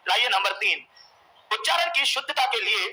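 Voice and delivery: ordinary talk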